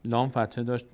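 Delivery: usual speaking voice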